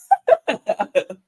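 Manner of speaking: amused burst of laughter. Real, not acted. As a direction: laugh at a joke. Amused at the host's joke.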